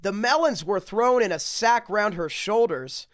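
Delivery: sing-song rhyming read-aloud; storybook reading. Acted. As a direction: read it like a bedtime picture book. Reads rhyming picture book aloud.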